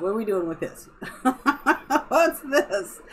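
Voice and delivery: words breaking into giggles; cracking up at a pet's antics; laughing. Real, not acted. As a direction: laugh helplessly through the question. Cracking up at the cat.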